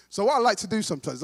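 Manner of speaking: normal talking voice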